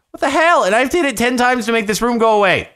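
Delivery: high, loud rant; loud, high-pitched frustration; exasperated outburst. Real, not acted. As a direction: yell in disbelief at the game. Outburst at the button room.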